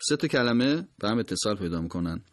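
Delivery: ordinary delivery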